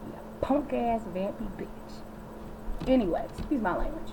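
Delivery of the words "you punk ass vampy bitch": slow quiet put-down; soft slow insult. Real, not acted. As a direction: drawl the insult under breath. Cursing Steve after the spelling.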